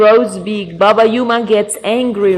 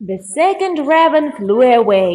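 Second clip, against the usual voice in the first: plain reading voice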